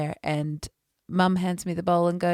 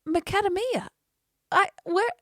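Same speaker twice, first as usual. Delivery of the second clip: high-pitched incredulous stammer; retold disbelief, pitched way up; baffled echo. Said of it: "And I'm like".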